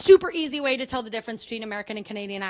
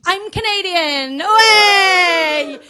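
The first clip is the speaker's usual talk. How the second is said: slow, louder, higher announcement into a cheer; triumphant reveal; 5 semitones higher, 10 dB louder, much slower than usual. Acted. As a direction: draw it out, then cry out in celebration.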